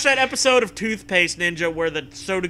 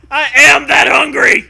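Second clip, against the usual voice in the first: shouting; 9 semitones higher, 15 dB louder than usual. Acted. Zombie role shouting in game.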